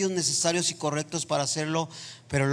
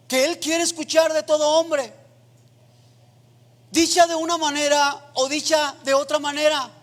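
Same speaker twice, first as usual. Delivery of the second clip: loud, high, fervent preaching voice, near chanting; full-force preaching; 8 semitones higher, 4 dB louder than usual. Acted. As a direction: preach it out to the whole church, impassioned. Predica a toda fuerza. (predicación a todo pulmón, voz alta y ferviente)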